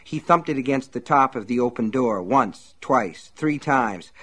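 ordinary delivery, nothing marked

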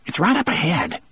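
high, edgy snap; jumpy, irritated high voice; nervous and irritable. A narrator's reading. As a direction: answer testily, pitched right up. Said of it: "sounding nervous and irritable".

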